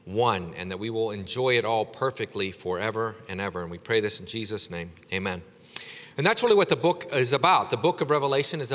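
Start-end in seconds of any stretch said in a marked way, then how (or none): 0.0-5.4 low, measured praying voice; closing prayer. Real, not acted. Preacher prays for his congregation in Jesus' name, low and prayerful. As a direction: bring the prayer reverently to a close.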